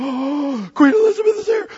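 high, loud startled shout; panicked surprise. Acted. Lady spotting the queen's carriages, high loud startled cry. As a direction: shriek it out in a sudden panic.

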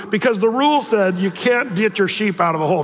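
ordinary talk, nothing marked

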